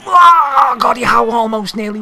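loud high cry at the near miss; excited shout. Real, not acted. Gamer reacting to a near goal, loud high shout. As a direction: yell it as the shot just misses.

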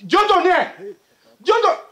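high-pitched cry of disbelief; shocked shouting. Acted. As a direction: call out the name in shock. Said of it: "no shout, no landlord".

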